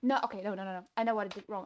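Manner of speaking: ordinary delivery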